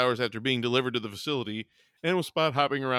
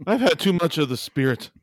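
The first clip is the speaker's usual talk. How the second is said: Scotsman voice; 4 semitones higher, 4 dB louder than usual. Acted. Scotsman blames the drink.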